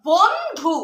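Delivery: বন্ধু with the ন light, not hit hard; soft n cluster. Shown as a demonstration. Teacher to pupils, showing a soft ন in বন্ধু. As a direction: ease the ন softly into ধু in বন্ধু. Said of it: "বলবো না জোরে করে, শুধু".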